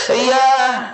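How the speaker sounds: ordinary talk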